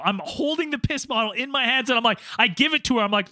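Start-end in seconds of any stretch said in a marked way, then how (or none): none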